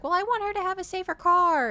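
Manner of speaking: very high, put-on mom voice; mom impression voice. Mom cutting in about a safer car, high mock voice. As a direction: pipe up as a fretting mom.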